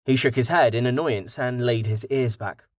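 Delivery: ordinary delivery, nothing marked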